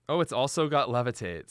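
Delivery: ordinary talk, nothing marked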